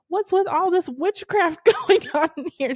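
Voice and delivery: high, loud, laughing mock outrage; laughing disbelief. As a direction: ham up a baffled first-timer through laughter. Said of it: "Brittany, she's like".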